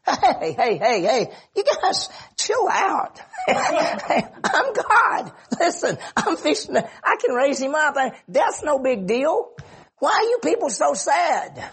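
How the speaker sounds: high, hammed-up chill-out voice; breezy dismissal. Preacher imagines a flippant Jesus addressing mourners, high and breezy. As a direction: wave the mourners' grief away in a mock upbeat voice. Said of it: "why didn't Jesus just go like this".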